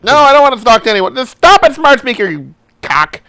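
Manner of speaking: high, loud, fast rising protest; exasperated scolding. Real, not acted. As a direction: tell off a misbehaving gadget, loudly. Scolding the misfiring phone assistant.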